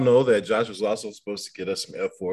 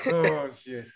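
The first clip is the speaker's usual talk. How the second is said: ordinary talk, nothing marked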